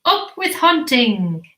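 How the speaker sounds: plain reading voice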